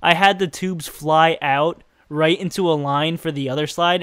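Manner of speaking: ordinary delivery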